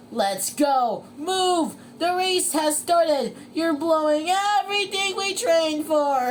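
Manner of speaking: high, loud frantic urging; SpongeBob shouting. Acted. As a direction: berate your racer from the sideline. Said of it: "shouted SpongeBob".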